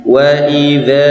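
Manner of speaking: drawn-out Quranic recitation; slow recitation. Shown as a demonstration. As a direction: stretch wa idza slowly, two beats on the vowel. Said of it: "contohnya ini".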